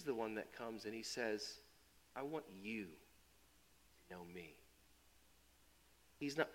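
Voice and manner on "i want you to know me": soft, slow, tender invitation; gentle God voice. Acted. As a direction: speak it like a quiet promise.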